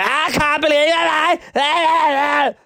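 imitating a high, drawn-out victory scream; mock shout. Imitating the contestant shouting when her team won, high slow mock shout. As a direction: stretch it into a piercing, over-the-top celebratory yell. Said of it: "she shouted in the V T".

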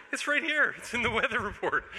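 laughing as he points at the report; chuckling in disbelief. Real, not acted. Laughing at a scary forecast.

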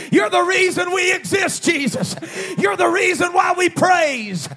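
shouted, high revival sermon; preacher shouting to rouse the congregation; full-force preaching. Real, not acted. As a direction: proclaim it in ringing phrases, stretching the last words.

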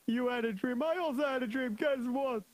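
high-pitched mocking soldier, opening on a laugh; scoffing soldier. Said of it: "so the soldier said".

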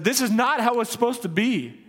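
anguished protest. Retold or imitated. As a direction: let the ache break through on not. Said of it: "sat with people ... and said".

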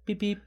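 high, short electronic beeps; imitated fob beep. Imitating friend's key fob on the car, high beeps.

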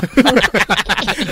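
high evil cackle; villain laugh. Acted. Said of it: "저 웃음은 악당 웃음이야".

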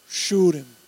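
put-on Louisiana swamp accent. Preacher quoting Louisiana alligator hunters, light swamp accent. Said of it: "people that talk like that".

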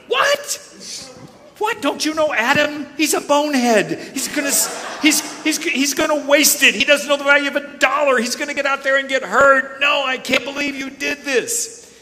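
high, loud, exasperated scolding; mock-scold like a furious mother; outraged mom. The mom scolds the dad for giving the son money, in a high, loud mock-outraged voice. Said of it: "what the mom says".

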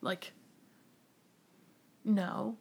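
soft, firm talking herself down; muttering a private pep talk; quiet self-talk. Retold or imitated. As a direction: hush yourself back to sense. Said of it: "and then I have to be like".

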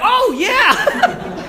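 very high, loud gleeful squeal; joyful. Retold or imitated. Fallen man being strapped into his chair, gleeful. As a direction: imitate the man's exuberant agreement. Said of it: "not just joyful".

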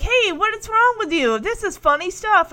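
frustrated. Retold or imitated. Podcaster retelling Michelle's failed joke, mock frustrated. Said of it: "Michelle gets frustrated".

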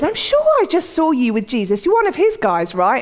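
high, insistent imitated accuser; accusing bystander. Bystander confronting Peter about Jesus, high and insistent. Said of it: "someone else says".

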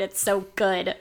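ordinary talk

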